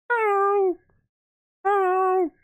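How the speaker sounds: very high, stretched falsetto hello; taunt impression. Gamer trying to mimic a taunt, falsetto impression. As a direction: squeal it out as an impression. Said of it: "That's all he does".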